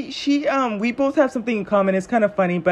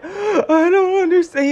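higher, slow, imitating a tearful man; retold tearful lament; mock crying; 8 semitones higher than usual. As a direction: whimper it like a heartbroken guy. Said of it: "he was in tears, like, crying".

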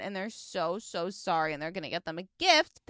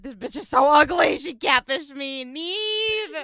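loud, angry complaint; angry shout; 3 semitones higher, 7 dB louder, slower than usual. Retold or imitated. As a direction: yell the complaint at Nev. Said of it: "It's because she's like".

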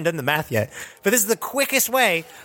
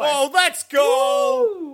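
high, drawn-out cheer, very slow; excited cheer; 12 semitones higher, much slower than usual. Real, not acted. Friends cheer a plus four roll, high drawn-out celebration.

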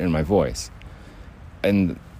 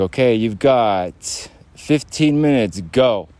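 mock-bossy timekeeper giving orders; bathroom bully; 3 semitones higher, slower than usual. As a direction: lay down the time limit like a pretend bully. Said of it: "The bathroom bully would be".

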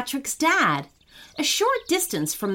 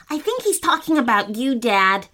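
ordinary delivery

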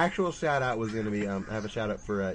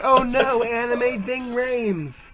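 mock cartoon voice; 12 semitones higher, 6 dB louder, much slower than usual. Mocking a character.

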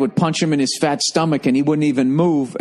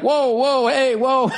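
higher, mock shouted whoa like a ref; mock referee shout; 7 semitones higher, slower than usual. Retelling how the referee stepped in, mock shout higher. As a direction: playfully act a ref breaking it up, higher. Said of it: "the ref is going like".

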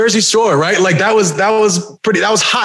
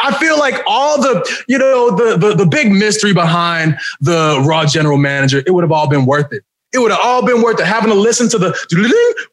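ordinary delivery

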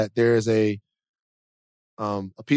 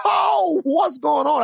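much higher, bright surprised hello; high excited greeting; slower than usual. Pretend. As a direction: jump way up in pitch, delighted. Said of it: "That's how he sounds to me".

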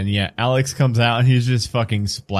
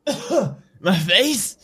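high, put-on vampire indignation; indignant old vampire; 10 semitones higher than usual. Old vampire accuses Marcus of gloating, high indignant voice.

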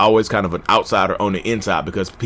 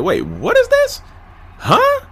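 slow, higher, rising to a shocked huh; retold surprise; much slower than usual. Reading about the disorder online for the first time, acting out his stunned surprise, higher and slow. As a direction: act out a double take, pitched up.

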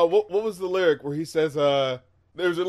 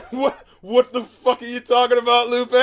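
higher, louder, laughing through the words; laughing incredulous; 4 semitones higher, 4 dB louder than usual. Real, not acted. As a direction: crack up while asking in disbelief. Laughing at absurd lyric.

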